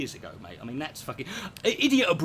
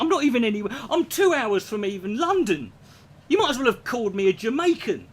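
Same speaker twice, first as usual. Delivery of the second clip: retold outrage; 7 semitones higher, 5 dB louder than usual. Retelling his outraged reply.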